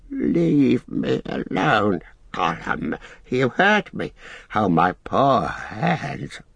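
slow, throaty creature sob, pitch lurching; Gollum sobbing in throat. Acted. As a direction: sob brokenly to yourself, gulping in the throat. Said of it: "he sobbed in his throat".

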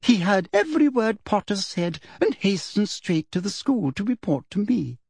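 high, self-satisfied schoolmistress; smug Umbridge voice. Acted. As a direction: say it smugly, pleased with herself.

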